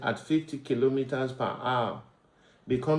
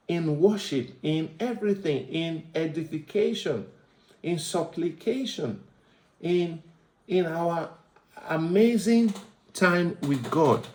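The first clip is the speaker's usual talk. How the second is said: building preaching; 6 semitones higher than usual. Acted.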